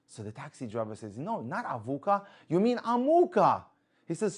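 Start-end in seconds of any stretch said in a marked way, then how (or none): 1.2-3.6 high, drawn-out correction, louder on Amuka; rising to an emphatic, high finish. Retold or imitated. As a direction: set the passenger straight, stretching the name. Driver corrects the passenger's place name.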